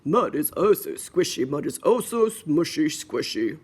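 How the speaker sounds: lightly voiced daddy pig, a bit low; a hint of a storybook dad. Daddy pig delighting in the muddy puddle, lightly voiced dad. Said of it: "said the daddy".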